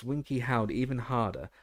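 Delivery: ordinary delivery, nothing marked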